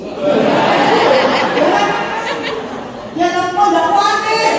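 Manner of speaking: high, loud incredulous reproach; exasperated retold outburst; worked-up wife. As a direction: demand an answer from a too-calm husband. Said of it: "Saya kan jadi emosi".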